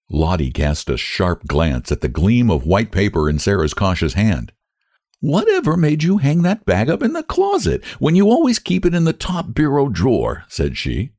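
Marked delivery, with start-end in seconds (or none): 5.2-10.4 put-on higher, prying older woman reproaching; a nosy spinster complaining about the hunt; Lottie's voice.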